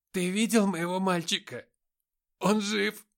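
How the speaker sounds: high, loud, frantic pleading; desperate plea. Acted. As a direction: beg in panic for her son. Desperate mother asks about son.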